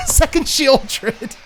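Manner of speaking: high, loud, slow cry of disbelief; disbelieving outcry. Real, not acted. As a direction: stretch it out in shock. Opponent plays second threat.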